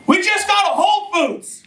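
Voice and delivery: high, thrilled news; breathless big news; excited announcement. Retold or imitated.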